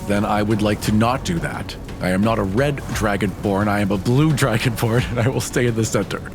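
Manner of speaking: normal talking voice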